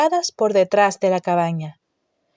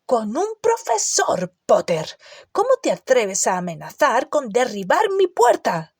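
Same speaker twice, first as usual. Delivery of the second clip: indignant scolding; 4 semitones higher than usual. A narrator's reading. Hagrid scolding Harry.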